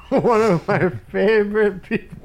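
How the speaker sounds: laughing through a put-on voice; amused mock quote. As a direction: crack up while playing the line back in mock voice. Mock repeat, amused co-host.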